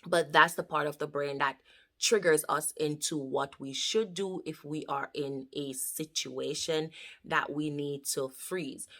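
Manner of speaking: ordinary delivery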